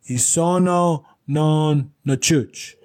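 imitating a learner's careful, drawn-out delivery; slow recital. [name] repeats his coached Italian to Joe, slow and careful.

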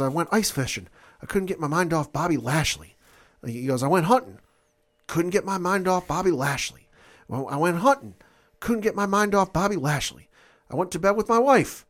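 lightly voiced mock Brock promo, rising; imitating Brock building up.

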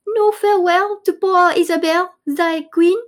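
high-pitched plaintive reproach; hammed-up stage complaint; slighted queen. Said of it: "And the queen's like".

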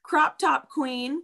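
high, slow, drawn-out compliment; quoting a compliment. Retold or imitated. Retelling a friend's compliment, high and slow. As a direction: savor each word of the praise. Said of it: "you said".

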